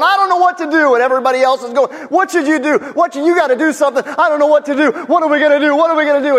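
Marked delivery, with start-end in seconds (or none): 0.0-0.9 high, loud mock panic; hammed-up frantic outburst; freaking out. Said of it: "everybody else is freaking out". 2.1-6.4 high, loud mock panic; hammed-up frantic outburst; freaking out. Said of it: "everybody else is freaking out".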